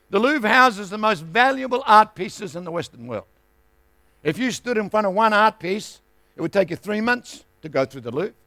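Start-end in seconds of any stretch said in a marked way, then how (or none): none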